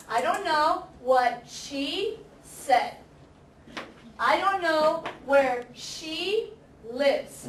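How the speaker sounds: slow, clear teacher modelling; careful classroom demo pace; slow model sentences. Shown as a demonstration. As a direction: lay out each word slowly for the class. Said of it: "Notice here".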